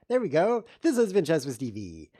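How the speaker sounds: loud upbeat outro; show sign-off. Acted. Signing off the chess show, loud announcer outro. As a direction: announce the show name with punch.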